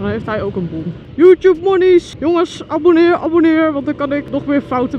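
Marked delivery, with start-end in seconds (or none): 1.1-2.1 high, loud, slow cartoon voice; hammed-up YouTube money voice; mock greedy voice. Joking about YouTube money. 2.2-3.7 excited plea. Acted. Begging viewers to subscribe.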